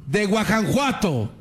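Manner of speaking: high, slow and proclaiming, a hammed-up patriot's address; rally the people from a podium, mock-grand; rousing speech. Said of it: "se pone a gritar".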